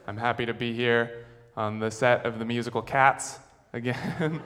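ordinary talk

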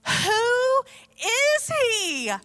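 high, drawn-out question in awe; awed wonder. Acted. Disciples realising who calmed the storm, awestruck. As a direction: stretch each word slowly in amazement.

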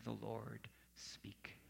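whispered, slow, as if straining to hear; hushed. Acted. Preacher to congregation, about hearing God, a slow whisper. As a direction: drop to a whisper to make them lean in.

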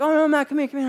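high, loud imitation of an insistent wife; mock high-pitched beckoning; calling him back. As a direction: call after someone slipping away.

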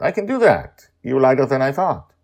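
put-on a young man pleasantly surprised; pleased surprise. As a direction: marvel at how light she is. Surprised at her lightness.

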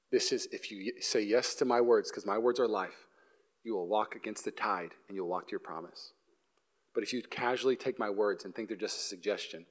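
hushed, low sermon voice; solemn preaching. Real, not acted. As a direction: drop soft and low to press a grave message. Solemn preaching after the comic story.

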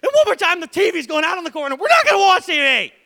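loud, high mock fury; mock yell at a nagging child; dad pretending to threaten burning the toys; hammed-up angry parent. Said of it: "I'm like".

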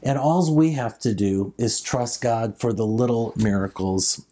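ordinary delivery

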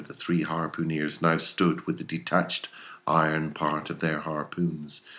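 ordinary delivery, nothing marked

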